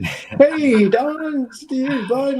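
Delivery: high, loud, buddy-buddy voice; jovial comic-book boss voice; Todd McFarlane impression. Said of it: "it's a Liefeld's impression of Todd McFarlane".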